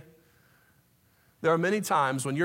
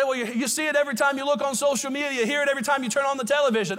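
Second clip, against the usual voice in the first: mocking objector; 7 semitones higher, faster than usual. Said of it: "You say".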